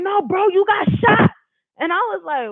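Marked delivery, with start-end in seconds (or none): 0.0-1.3 higher and louder, retold insistence; urgent insisting. Boyfriend correcting her grazed claim, louder higher insistence. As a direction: make her hear it, you got shot.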